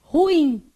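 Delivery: "Ruim" loud, two syllables stressed on the final -IM; careful standard reading. Shown as a demonstration. Teacher showing the standard reading, loud final stress. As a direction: ring out "Ruim" as ru-IM. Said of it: "O que que tá escrito?".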